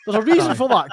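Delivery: usual speaking voice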